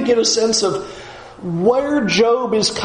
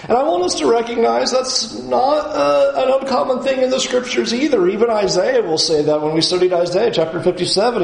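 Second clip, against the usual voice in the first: ordinary talk, nothing marked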